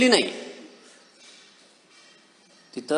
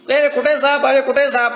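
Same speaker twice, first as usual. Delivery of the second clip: dismissive scoff; 7 semitones higher, 4 dB louder than usual. Retold or imitated. Villager mocking the snake fear.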